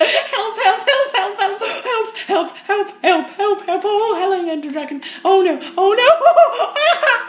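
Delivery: panicked screaming laugh, very high; terrified gibbering. Real, not acted. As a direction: shriek and laugh in terror. Caught by the killer.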